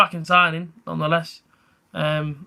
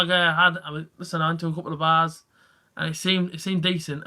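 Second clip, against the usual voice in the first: ordinary talk, nothing marked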